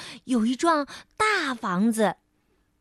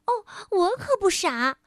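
elf voice; 6 semitones higher than usual. Acted. Elf answers.

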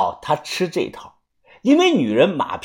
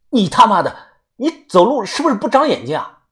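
angry cursing; 8 semitones higher than usual. Acted. Man rounding on the stranger who bumped him, angry. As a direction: snap at the one who bumped you.

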